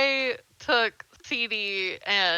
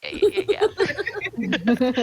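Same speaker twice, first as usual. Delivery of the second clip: eager yeah; 5 semitones higher, slower than usual. Retold or imitated. Retold eager answer.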